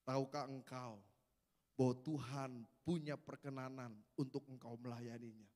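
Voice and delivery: lightly voiced pastor praying over him; solemn preacher. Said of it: "dia bilang begini".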